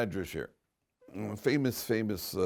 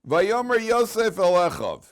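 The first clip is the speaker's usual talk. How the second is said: high, loud, slow proclamation; soaring scripture reading; verse recitation; 10 semitones higher, 10 dB louder, slower than usual. Acted. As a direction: declaim the Hebrew verse at full voice.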